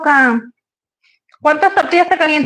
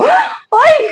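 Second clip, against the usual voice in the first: mock yelp of pain; 13 semitones higher than usual. Mock pain mid-sentence.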